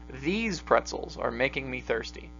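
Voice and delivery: THESE pretzels, the word "These" leaned on; "These" stressed, the rest said level. Shown as a demonstration. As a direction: hit "These" so it points at these pretzels only. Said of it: "That implies that, you know, maybe there's other pretzels".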